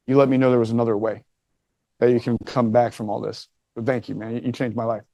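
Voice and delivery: sincere thanks. Retold or imitated. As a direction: voice a kid's gratitude quietly and simply. Kid's heartfelt thanks, retold.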